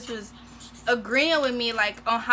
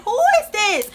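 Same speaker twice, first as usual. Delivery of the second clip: loud, high incredulous question; retold outburst of disbelief; shocked disbelief; 9 semitones higher, 7 dB louder than usual. Retold shock at his new manner.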